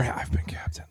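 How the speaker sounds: soft mock ASMR whisper. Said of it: "you were gonna do the A S M R".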